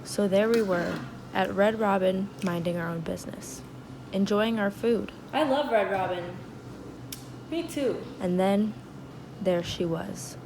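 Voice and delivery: hushed storyteller voice; dramatic narration. Acted. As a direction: narrate it like a soap opera. Quiet dramatic reenactment narration.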